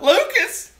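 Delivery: sharp high yell of the name. Retold or imitated. Wife yelling at her fallen husband, loud high call. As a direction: shout his name across the store.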